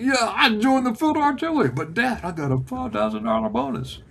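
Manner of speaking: retold protest. Son justifying his enlistment to dad, protesting. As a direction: plead your case like a teenage son.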